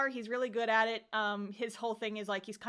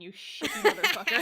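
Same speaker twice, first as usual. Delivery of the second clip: mock insult; 6 dB louder than usual.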